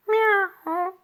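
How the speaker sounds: high, sweet mewing cat; high cat meow. Acted. Narrator voicing a cat, high playful meow.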